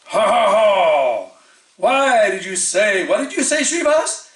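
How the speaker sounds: big, high theatrical roar, drawn out; mock-mighty roar of an outraged master. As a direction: boom it slowly, rising to a high-pitched demand. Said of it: "Chaitanya Mahaprabhu then roared".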